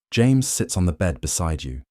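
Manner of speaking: ordinary delivery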